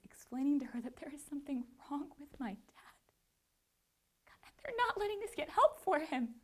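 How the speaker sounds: hushed, voice thinning and climbing in pitch; softly plead, close to breaking down; choked-up distress. Retold or imitated. Daughter explaining dad's emergency to attorney, choked-up and distressed. Retelling plea for stricken father.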